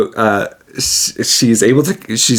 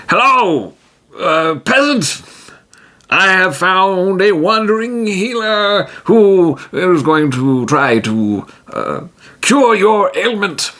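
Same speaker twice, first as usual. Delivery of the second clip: much higher, pompous heroic voice; a proud hero booming praise; grand hero voice; 10 semitones higher than usual. Acted. T-Jim addresses the orc.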